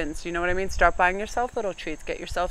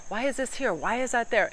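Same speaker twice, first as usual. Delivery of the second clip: imitated ranting husband; 6 semitones higher than usual. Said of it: "he starts ranting and raving".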